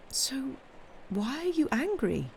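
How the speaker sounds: high, searching question; puzzled pleading. A narrator's reading. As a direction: hesitate after the first word, then plead. Puzzled granddaughter asks grandfather why.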